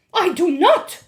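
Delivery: loud, high witch shout. A narrator's reading. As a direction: bark the denial at her. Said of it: "shouted the Grand High Witch".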